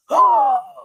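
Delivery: sharp, loud, very high gasp of fake shock. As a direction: gasp as if the bubble just burst. Sudden mock shock.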